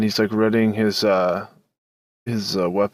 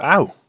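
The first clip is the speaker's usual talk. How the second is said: higher, louder acted "ow"; pained yelp; 7 semitones higher, 5 dB louder than usual. Player's character recoils from a slapped hand, acted yelp. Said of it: "reel back and just be like".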